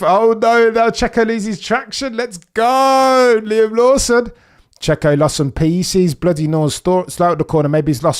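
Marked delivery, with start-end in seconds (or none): 0.0-2.2 alarmed cry. Real, not acted. Live reaction to a driver's spin. 2.2-4.3 high, loud cheer of "Let's go"; cheering shout. Real, not acted. Cheering a driver after his defensive move, a high loud shout.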